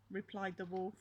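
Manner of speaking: usual speaking voice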